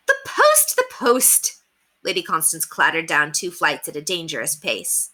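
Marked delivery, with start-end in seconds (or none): 0.1-1.6 excited cry. A narrator's reading. Lady rushing downstairs for the post, high excited cry. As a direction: squeal it out as she rushes down.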